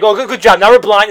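loud, exasperated mock praise; sarcastic outrage. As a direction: jeer at a bungling hero. Crowd sarcastically blaming Booster.

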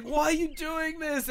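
high-pitched helpless protest; mock pleading. Host voicing a severed head forced to watch, mock pleading. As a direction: plead like a helpless victim, hammed up.

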